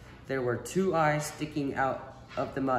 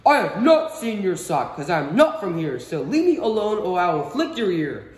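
higher, louder fly warning; a higher, louder fly warning off the boy; 6 semitones higher, 9 dB louder than usual. Acted. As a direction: buzz a threat at the boy. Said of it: "The fly warned me".

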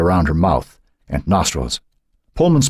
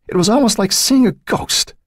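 high, haunted father's voice; distraught, much higher pitch; shaken disbelief. A narrator's reading. Shaken father describes finding daughter.

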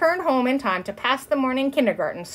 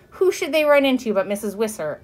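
usual speaking voice

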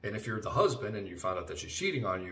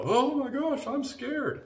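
much higher, louder hammed-up fright; mock scared; 5 dB louder, slower than usual. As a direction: parody a wife faking fear. Mocking wife's fake fear.

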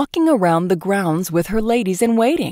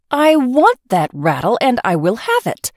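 a touch louder and higher, spoiled insistence; demanding; 4 semitones higher, 4 dB louder than usual. Acted. Princess insisting to the swineherd, spoiled demand. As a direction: stamp your foot verbally.

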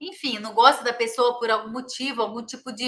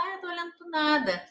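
put-on higher, softer voice for an elderly patient; hint of a quiet old man answering; lightly acted withdrawn patient; 5 semitones higher, 6 dB quieter than usual. Old man answers his caregiver, lightly acted withdrawn reply. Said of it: "ele começa a ficar mais quieto".

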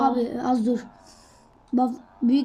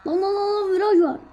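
alarmed warning; 6 semitones higher than usual. Real, not acted. Warning during race.

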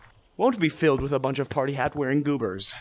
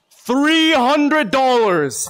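excited; 7 semitones higher, 5 dB louder, much slower than usual. Real, not acted. Excited donation read.

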